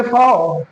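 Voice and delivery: फाओ with L turned into an "o" glide; drawn-out फाओ, tongue never landing on L; dark L demo. Shown as a demonstration. Teacher models the correct word, dark L demo. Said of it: "बल्कि फाओ".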